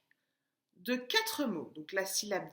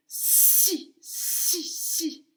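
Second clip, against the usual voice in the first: "si" punched out three times; "si" repeated with a long hissed s; isolated first syllable; 7 semitones higher, 4 dB louder, much slower than usual. Shown as a demonstration. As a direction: hit each "si" as a separate, emphatic beat. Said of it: "tu as trouvé la première syllabe".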